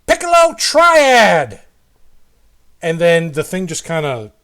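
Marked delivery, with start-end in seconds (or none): 0.0-1.6 much higher, loud, drawn-out shout; shout down the hallway. Acted. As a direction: call the command out across a long hall. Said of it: "he shouts down the hallway at it".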